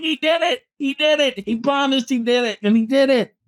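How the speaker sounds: high, thrilled shouts of victory; retold excited cheering. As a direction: relive the joy of the win, pitch way up. Cheering Ali's win at screening.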